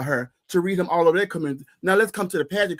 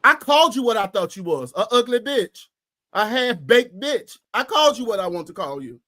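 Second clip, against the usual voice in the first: heated argument; 4 semitones higher than usual. Retold or imitated. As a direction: tell her off with rising heat. Heated retold phone argument.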